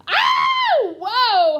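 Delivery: high, loud astonished cry; amazed shout. Real, not acted. Tutor shouting in amazement at the student's answer. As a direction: burst out in delight.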